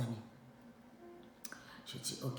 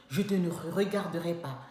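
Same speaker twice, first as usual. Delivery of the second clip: ordinary talk, nothing marked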